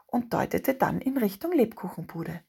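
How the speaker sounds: plain reading voice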